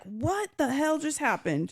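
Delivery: mock panicked disbelief; freaking out. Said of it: "Dane's freaking out because he's like".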